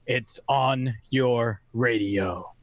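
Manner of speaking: ordinary talk, nothing marked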